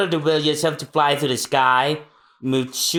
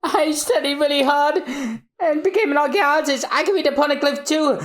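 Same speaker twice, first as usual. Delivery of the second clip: put-on high girl's voice; hopeful daughter; 12 semitones higher than usual. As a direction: tell her proudly. Robin tells mother proudly.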